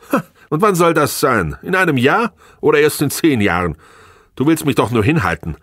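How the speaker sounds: high, loud, disbelieving challenge; humorless scoff. A narrator's reading. Wife doubting husband's promise to pay, scornful and humorless. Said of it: "Sie lachte humorlos auf".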